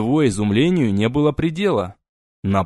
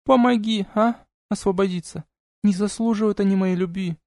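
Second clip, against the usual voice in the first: pleading demoness; 8 semitones higher than usual. A narrator's reading.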